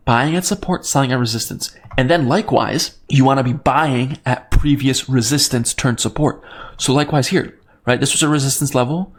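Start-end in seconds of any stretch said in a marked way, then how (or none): none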